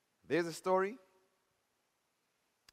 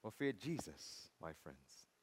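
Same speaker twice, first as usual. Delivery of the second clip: very soft and low; quiet, sinking pulpit warning; hushed solemnity; 11 semitones lower, 13 dB quieter, slower than usual. Real, not acted. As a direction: drop to a reverent hush.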